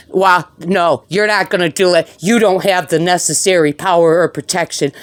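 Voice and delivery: normal talking voice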